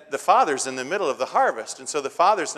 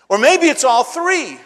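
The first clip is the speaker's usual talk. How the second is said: much higher and louder, slowed for the joke; preaching punchline; 13 semitones higher, 11 dB louder than usual. Acted. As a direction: land the zinger big from the pulpit.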